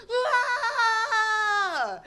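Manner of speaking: long, high scream falling at the end; sustained high crowd squeal; cheering crowd scream. Retold or imitated. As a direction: imitate a whole crowd cheering. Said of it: "everyone goes".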